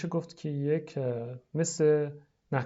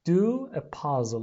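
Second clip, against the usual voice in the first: very slow, loud teacher model; slow model phrase; 3 dB louder, much slower than usual. Shown as a demonstration. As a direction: stretch each word for the class. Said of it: "لطفاً تکرار کنید".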